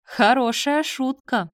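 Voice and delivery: high, loud exclamation of amusement; hysterically amused. A narrator's reading. Nadya taking the confession for a joke, hysterically amused. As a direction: treat it as a hilarious joke. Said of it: "хохотала она".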